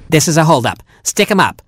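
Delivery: lightly voiced robin holdup; mock robber. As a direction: play the little bandit.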